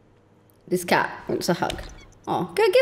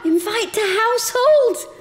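cutesy pet voice; 12 semitones higher than usual. Real, not acted. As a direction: coo the words to the dog. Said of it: "I stopped talking like a".

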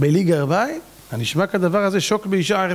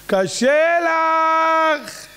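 high, hugely stretched wail; hammed-up drawn-out moan; mocking whine; 10 semitones higher, much slower than usual. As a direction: drag it out as a mock complaint. Mocks a complaint he hates.

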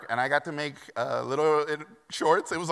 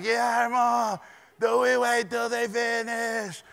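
shouting over noise; 10 semitones higher, 4 dB louder, slower than usual. Retold or imitated. Asking over the leaf blowers.

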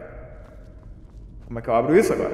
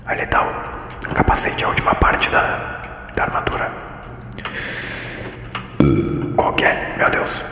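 voiceless whispered game commentary; ASMR whisper; 8 semitones lower, 4 dB quieter than usual. Pretend. Streamer puzzling over the game for viewers, ASMR whisper. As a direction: keep every word in a whisper. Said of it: "A S M R na caverna".

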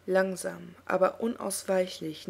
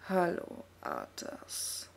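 very low, dark demonic greeting; deep dark voice; 4 dB quieter, much slower than usual. A narrator's reading. Illidan greets Arthas in a deep, dark demon voice. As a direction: let it rumble from deep down, sinister. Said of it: "Tief und finster klang seine Stimme".

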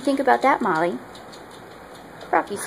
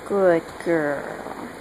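ordinary delivery

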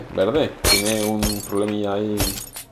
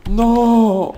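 high, loud, drawn-out "no"; dismayed cry; 12 semitones higher, 7 dB louder than usual. Real, not acted. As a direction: cry out as it all goes wrong. Fails in the game.

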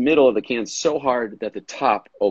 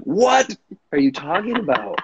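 höher, verwundert ungläubig; mock bafflement, clearly higher; baffled what; 9 semitones higher than usual. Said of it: "I'm like".

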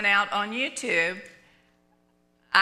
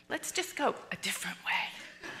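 whispered; 9 dB quieter than usual. Retold or imitated. Worried wife urging husband.

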